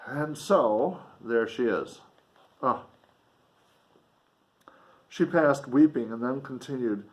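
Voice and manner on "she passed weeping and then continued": put-on measured reading of an old novel; earnest literary read-aloud; story narration. A narrator's reading. Reading resumes.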